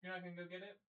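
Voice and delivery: soft cooing dog talk, often high. Real, not acted. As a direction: coo softly at the dog, curious. Talking to the dog.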